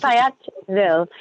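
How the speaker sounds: Fayetteville in a put-on local accent; attempted local accent. Shown as a demonstration. Agent copying the accent she was told to use, put-on local accent. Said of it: "gotta say it with an accent".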